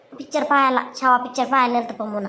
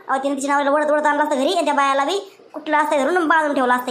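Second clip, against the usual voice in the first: normal talking voice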